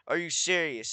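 ordinary talk, nothing marked

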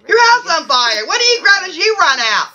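ordinary delivery

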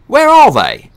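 high, loud, curt question; impatient magician demanding answers; rude demand. Acted. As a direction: bark it, asserting authority. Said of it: "Dee asked rudely".

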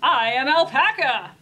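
loud, proud announcement as Alpaca; Alpaca character voice. A narrator's reading. Alpaca answers Llama in the story, loud character voice. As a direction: declare it loudly, in character as an alpaca. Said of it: "answered Alpaca".

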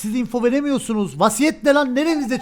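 high, loud indignant rant; angry shouting. Real, not acted. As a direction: shout the objection. Heated in-game argument.

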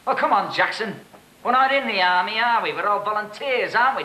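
officer's forced heartiness; mock jovial. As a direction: coax him with false cheer. Officer feigns jovial persuasion.

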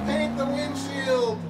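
very high, drawn-out mock yell. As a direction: stretch it out high, without real volume. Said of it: "other members of the team are yelling".

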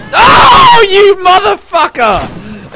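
outraged yell at a betrayer; furious shout. Real, not acted. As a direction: roar it out, stretched and loud. Teammate about to kill him.

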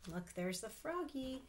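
usual speaking voice

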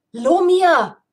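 loud, high call of a name; shouting to a friend; sharp shout. Acted. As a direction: yell to get his attention. Calling companion's name sharply.

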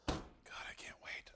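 eager little whisper to himself. Real, not acted. Whispering over the soup with anticipation. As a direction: breathe it, barely voiced. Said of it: "I don't know why I'm whispering".

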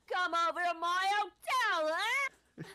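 put-on high loud Cockney accent. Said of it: "the Cockney accent".

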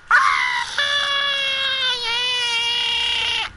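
high whooping cry of victory; triumphant cry. Real, not acted. Celebrating a kill.

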